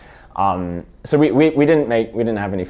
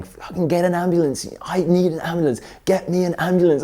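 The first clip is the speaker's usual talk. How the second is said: higher, imitated demand for an ambulance; a half-hearted yell, pitched up; mock yell; 9 semitones higher than usual. Girlfriend yelling at [name] for an ambulance, mock yell. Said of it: "she's yelling at me saying".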